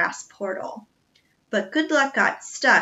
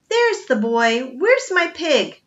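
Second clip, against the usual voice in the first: louder, slow, menacing demand; angry cook; 5 semitones higher, 7 dB louder, slower than usual. A narrator's reading. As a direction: growl out the demand like a brute with a cleaver. Cook with cleaver hunts pig.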